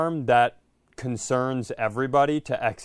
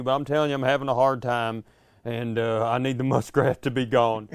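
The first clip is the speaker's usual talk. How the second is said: unraveling hillbilly; faster than usual. Retold or imitated. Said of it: "I sound like an unraveling, like, hillbilly".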